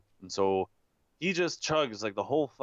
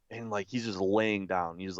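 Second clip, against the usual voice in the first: usual speaking voice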